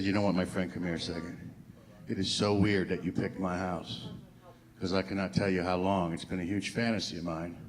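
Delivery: sarcastic come-on to the mailman, soft and low; mock intimate. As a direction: lean in and purr the sarcasm. Mock seductive reply to mailman.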